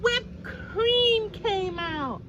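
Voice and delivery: high, loud, slow drawn-out reveal; big reveal. Acted. Reader revealing the surprise to the child. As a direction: stretch it out with delight.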